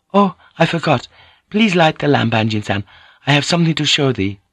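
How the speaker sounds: put-on high, accented woman's voice; playful, pleading lady lover; eager Japanese lady. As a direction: coax your lover sweetly, pitched well up. Voices Mariko in the dialogue.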